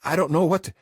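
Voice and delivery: high, loud, breaking off in panic; panicked words cut short; frightened. Acted.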